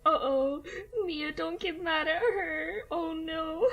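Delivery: high, loud worried plea; hammed-up anxious pleading with a cat; mock worry. Owner begging Mia not to get mad, mock worried. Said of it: "which I was like".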